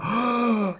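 mimicked audience gasp; big mock-shocked intake of breath. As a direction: gasp like a scandalised crowd.

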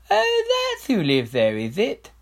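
high, slow offhand royal voice; airy king. Acted. King feigning ignorance to his adviser, high and airy. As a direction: toss it off airily. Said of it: "said King Fred airily".

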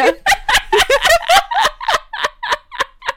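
long, high-pitched laughing fit; helpless laughter. Real, not acted. Cracking up at own awful impression, helpless laughter. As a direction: crack up completely.

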